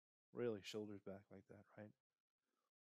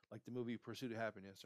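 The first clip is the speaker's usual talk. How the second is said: ordinary delivery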